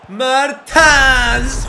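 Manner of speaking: huge, high, rising roar of the name; goal shout. Real, not acted. Gamer celebrating his striker's goal with a roar. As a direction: scream the scorer's name as the ball goes in.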